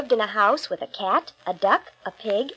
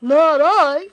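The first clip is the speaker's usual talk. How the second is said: slow, loud, dopey goose voice; silly goose; 5 semitones higher, 7 dB louder than usual. Acted. Goose refuses.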